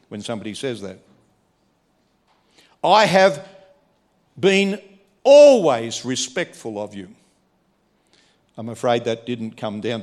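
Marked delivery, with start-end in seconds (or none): 2.8-7.1 higher, louder indignant complaint; aggrieved protest. Acted. Older brother protesting to his father, louder and aggrieved. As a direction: throw your loyalty back at him.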